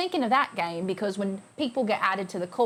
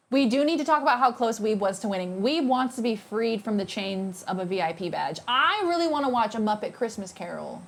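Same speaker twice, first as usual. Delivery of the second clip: ordinary talk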